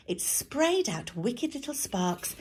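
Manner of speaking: usual speaking voice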